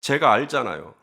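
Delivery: ordinary talk, nothing marked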